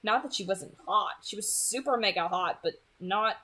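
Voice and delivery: ordinary delivery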